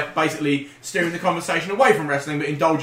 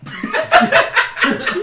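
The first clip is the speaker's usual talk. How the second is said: a high, breathless burst of laughter; high unvoiced laugh; 4 dB louder than usual. Real, not acted. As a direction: wheeze with laughter.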